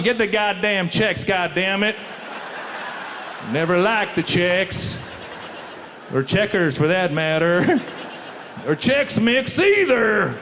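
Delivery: slow, lightly voiced dumb bigot. Comic mocking dumb tweeters: slow lightly voiced bigot. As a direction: drawl it out like a proud idiot.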